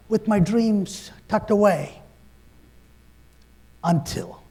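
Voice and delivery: normal talking voice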